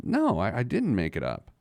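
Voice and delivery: ordinary talk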